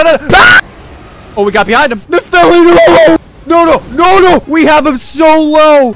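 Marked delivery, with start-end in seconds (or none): 0.3-1.3 loud unpitched scream; sudden harsh game scream; startled scream. Real, not acted. Hit while getting greedy. 2.1-5.9 high, loud cries of no; drawn-out, high-pitched desperate protest; desperate shouting. Real, not acted. Ally in danger in game.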